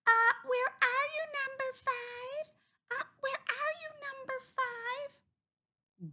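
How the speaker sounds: very high, squeaky duck mother calling out; high-pitched worried calling; Mrs. Duck calling. A narrator's reading. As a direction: call for a missing duckling in a high duck voice. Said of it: "stood on her doorstep calling".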